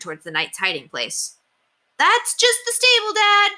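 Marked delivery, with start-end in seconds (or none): 2.0-3.5 much higher, loud and hurried deflection; loud, to cover up. Acted. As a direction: say it loud so the hidden knight hears. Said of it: "William said loudly".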